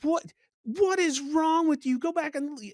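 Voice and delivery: loud, high-pitched outraged rant; screaming at phone. Retold or imitated. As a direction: re-enact yelling furiously at a recording. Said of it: "was screaming at the, my phone".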